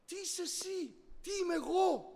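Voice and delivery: "Τι είσαι εσύ; searching question. Shown as a demonstration.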